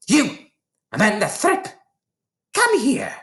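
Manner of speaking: high, bellowing call across the playground; shouting a pupil's name in a high, put-on voice; Trunchbull shouting. A narrator's reading. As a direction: yell the name like a furious headmistress. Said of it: "she was shouting".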